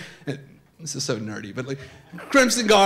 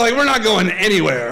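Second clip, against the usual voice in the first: ordinary talk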